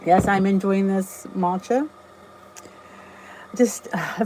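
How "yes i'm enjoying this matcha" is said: ordinary delivery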